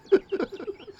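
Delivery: quick high giggle; a short squeal of a laugh. Real, not acted. Reader giggling at dark nonsense, high-pitched. Cracks up.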